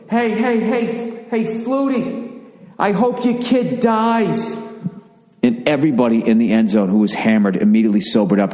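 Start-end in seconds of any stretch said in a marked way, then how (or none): 0.1-4.6 very high, halting, slow heckle; stuttering heckler. Retold or imitated. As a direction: stammer out a shocking insult. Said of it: "out of nowhere he just goes".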